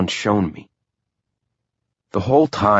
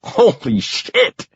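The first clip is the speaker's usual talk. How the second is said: cracking up; 7 semitones higher, much slower than usual. A narrator's reading. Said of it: "I crack up".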